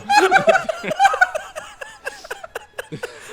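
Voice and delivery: a long real laugh that climbs high and fades; high burst of laughter. Real, not acted. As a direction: crack up helplessly at your own story. Laughing at own embarrassing story.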